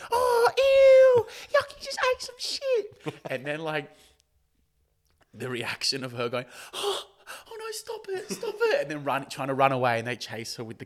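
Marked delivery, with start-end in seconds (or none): 0.1-3.1 very high, loud squeal of disgust; mock disgusted shriek. Onlookers watch her eat it, mock disgusted shrieking. 6.7-8.8 very high, squealing hammed-up distress; mock horror. Character reacts to being tricked, mock horrified squeal.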